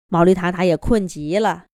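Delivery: ordinary delivery, nothing marked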